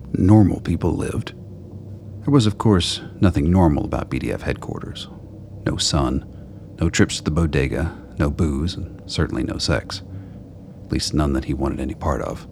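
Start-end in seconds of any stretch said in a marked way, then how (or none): none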